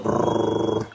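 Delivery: low rolled brrr; helicopter noise. Acted. Storyteller as the helicopter pulls up, imitated rotor noise. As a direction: trill it like rotor blades.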